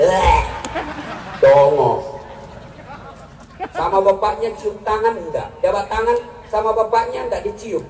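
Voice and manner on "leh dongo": a blunt, scornful jab at a silly youth; mock insult. Preacher insults foolish youth.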